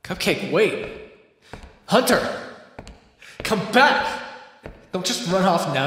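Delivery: high, loud calls after her; desperate shout. Acted. Caged vampire shouts after the hunter leaving him to starve, desperate and loud. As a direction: yell after someone walking out.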